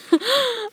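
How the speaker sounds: high scornful burst of laughter; a sharp, high mocking laugh. A narrator's reading. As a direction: laugh down at grandma. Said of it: "began laughing hysterically".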